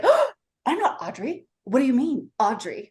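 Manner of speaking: high, startled protest; flustered denial. Pretend. An imagined rattled Madison denying the name Audrey, up high. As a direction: act rattled and defensive, voice up high.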